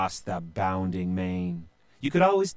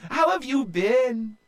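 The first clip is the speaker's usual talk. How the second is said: tender sister; 13 semitones higher, 3 dB louder than usual. A narrator's reading. Sister tenderly greeting her little brother, very high. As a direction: melt with affection. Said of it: "she said".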